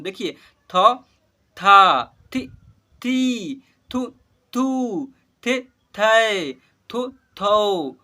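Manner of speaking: slow, loud, drawn-out syllable recitation; syllable drill. Shown as a demonstration. Teacher leads class in reciting barahkhadi, slow and loud. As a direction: call out each syllable clearly for the class to repeat. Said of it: "वही शब्द का उच्चारण कर रहा हूँ".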